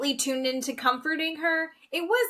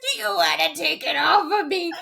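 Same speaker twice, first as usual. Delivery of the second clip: hammed-up wailing breakdown; 12 semitones higher, 6 dB louder than usual. Host imitates woman pleading with her partner, hammed-up tearful wail. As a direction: wail it out as an exaggerated crying plea. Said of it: "Full breakdown".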